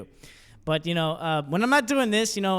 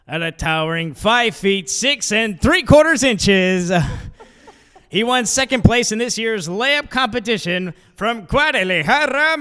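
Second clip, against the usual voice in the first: loud, slow, drawn-out lineup intro; starting lineup announcer; 3 semitones higher, 7 dB louder, slower than usual. Retold or imitated. Comic imitating an announcer introducing a Mexican player, grand and slow. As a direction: stretch each name like an arena introduction. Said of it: "It'd be something like".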